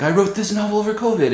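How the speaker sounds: giddy quick boast; excited reveal. Retold or imitated. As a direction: blurt out the big news gleefully. Brandon's excited reveal, retold.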